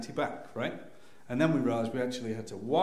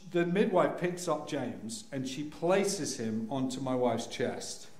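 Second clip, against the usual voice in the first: normal talking voice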